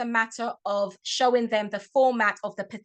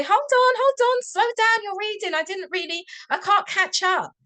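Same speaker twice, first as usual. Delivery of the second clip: much higher and louder, hammed-up panic; mock flustered plea; 9 semitones higher, 5 dB louder than usual. Shown as a demonstration. Teacher pretending to children she can't keep up, hammed-up plea. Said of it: "you can say".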